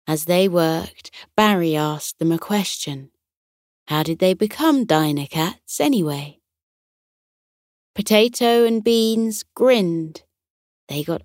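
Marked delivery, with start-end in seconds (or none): none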